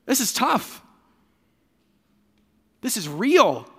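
a hint of a heartsick Jesus groaning; mock sad Jesus. Jesus at the tomb, lightly voiced and dejected. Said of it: "He's sad".

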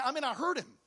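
normal talking voice

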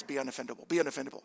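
low, soft mock sermon echo; a nagging voice in the head, low and soft; mocking inner voice. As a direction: murmur it like a nagging memory. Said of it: "all I hear is".

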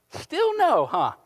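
high teasing "still no"; playful teasing. Real, not acted. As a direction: tease him with a lifted voice. Teasing the volunteer.

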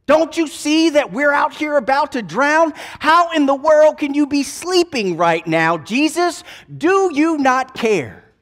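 frightened sailors crying out over the storm; terrified disciples yelling at a sleeping Jesus; panicked. Acted. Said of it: "they flip out on Jesus".

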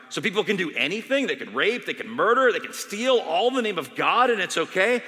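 loud, rising outraged questions; indignant challenge. Retold or imitated. Dying doctor confronting the chaplain, outraged. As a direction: throw each crime at her.

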